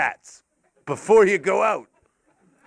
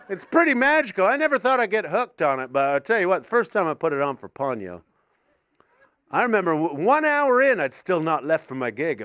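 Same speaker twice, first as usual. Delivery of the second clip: ordinary talk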